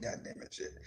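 unvoiced curse under breath; muttered curse. Real, not acted. Host cursing under her breath on stream.

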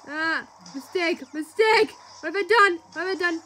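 high loud panicked cry; panicked shouting. Real, not acted. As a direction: yell at your own blunder. Mistake in the race.

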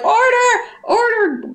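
high, mock-shouted Order! Order!; mock judge yelling order. Judge silencing the court, hammed-up shout. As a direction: bang the gavel and yell for order, hammed up. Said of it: "he's like".

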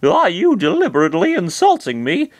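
Chris's voice. Acted. Chris asks Master Hand if he is insulting him, wary. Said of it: "Chris said".

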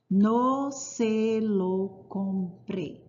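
very slow, word by word; drawn-out model sentence. Shown as a demonstration. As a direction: stretch each syllable out for learners. Teacher models the Spanish example sentence.

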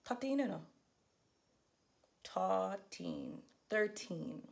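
Crucian way of saying thirteen; Virgin Islands accent. Shown as a demonstration. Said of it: "so without my dialect".

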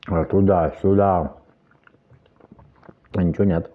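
chewing while talking; speaking around food; mouth full. Real, not acted. Seen 4: Cheeks bulge and jaw works while talking; watermelon bites before.

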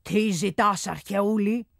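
a hint of a stern unseen god. A narrator's reading. Hidden voice questions the boy, a hint of sternness. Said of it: "Ακούστηκε μια αυστηρή φωνή".

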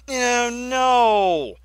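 high, loud dismay; dismayed cry. Real, not acted. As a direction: groan in horror. Player dismay.

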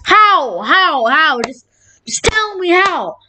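high, wailing repeated question; exasperated disbelief. Real, not acted. Gamer baffled by a death, exasperated disbelief.

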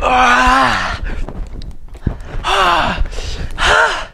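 high, pained gasps, mouth on fire; mouth burning from spicy food. Real, not acted. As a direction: groan through the burning heat.

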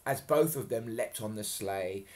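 ordinary delivery

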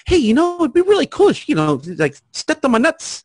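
bright chipper voice. Shown as a demonstration. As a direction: sell it like a happy salesman. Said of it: "As opposed to, I'm like".

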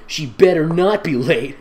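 usual speaking voice

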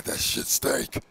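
whispered symbiote voice; breathy whispered monster; Venom whisper. Acted. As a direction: rasp it out as the creature in his head.

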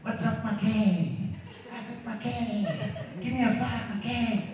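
quiet slow mock slang greeting to the mirror; practising slang in the mirror. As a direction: try out the cool greeting on yourself. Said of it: "I was watching me and I was going".

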